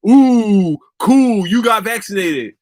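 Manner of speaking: mildly mocking people who post their vaccine; drawn-out sarcastic mock cheer at social media posters; sarcastic mock praise.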